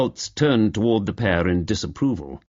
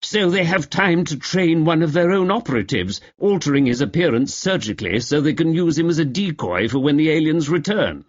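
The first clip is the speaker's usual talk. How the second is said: complacent; 6 semitones higher than usual. Acted. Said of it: "replied Lester complacently".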